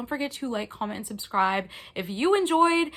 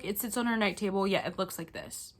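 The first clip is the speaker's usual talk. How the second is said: ordinary talk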